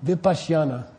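Vipassana said as vi-PAHSH-ya-na; Sanskrit pronunciation. Shown as a demonstration. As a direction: say Vipassana with a "shy" glide, "vi-pahsh-yah-na". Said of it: "If you wanna corrupt it, you could say".

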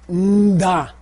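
NDA with a held nasal hum before the D; prenasal. Shown as a demonstration. Teacher gives word for arrow, prenasal nda. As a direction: draw out the nasal start of "nda". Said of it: "It's a ma before the da".

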